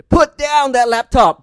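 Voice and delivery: very loud, high and slow mock command; furious wife. Wife to laptop-bound husband, a huge mock-angry order. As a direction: roar it like a wife swinging a club. Wife with baseball club enforcing Sabbath.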